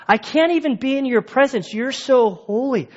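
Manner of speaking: high, stricken, trembling with awe; awestruck plea. Retold or imitated. Peter on his knees before Jesus, high and stricken. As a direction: fall on your face and plead.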